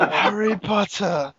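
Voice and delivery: high, drawn-out villain voice; mock film villain. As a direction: stretch it out like a hammy villain. Playing a Harry Potter villain.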